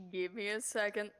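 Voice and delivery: ordinary delivery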